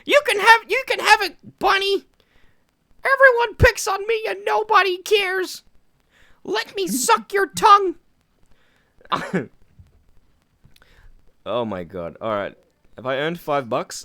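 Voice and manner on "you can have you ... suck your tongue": much higher, louder squeaky falsetto; gleeful high falsetto announcement; Mickey Mouse. Pretend. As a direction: shout it like a giddy cartoon mouse. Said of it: "Mickey Mouse".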